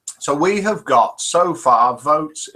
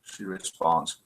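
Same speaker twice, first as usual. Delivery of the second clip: normal talking voice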